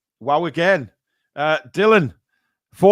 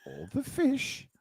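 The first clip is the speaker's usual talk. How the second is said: high amused echo; 8 semitones higher, 9 dB quieter, slower than usual. Acted. Repeating a funny super chat.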